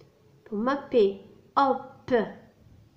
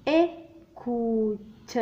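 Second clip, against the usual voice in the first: ايكوت drawn out even slower, syllable by syllable; very slow teaching read of ايكوت; slow spelled-out word; much slower than usual. Shown as a demonstration. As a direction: let each part of ايكوت ring out.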